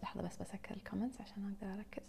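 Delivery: hushed unvoiced whisper, off to the side; whispered aside. Real, not acted. Host pausing to close comments, whispering to herself. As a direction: drop to a whisper while fixing the comments.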